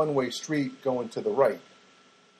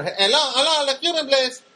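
put-on higher, louder Spanish-accented phone greeting; mock Spanish accent; 10 semitones higher, 6 dB louder than usual. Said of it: "They've got the Spanish accent".

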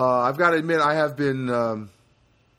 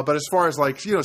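usual speaking voice

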